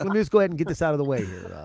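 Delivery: fast, hurried request; rushed plea. Retold or imitated. Comic begs organizers to go early, fast. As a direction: rush it out to get it over with. Rushing to go first.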